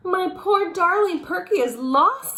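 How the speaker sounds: high, loud wail of a fussy lady; a dramatic cry over her lost pet; distressed owner. A narrator's reading. Missus Wood hears Perky is lost, high dramatic wail.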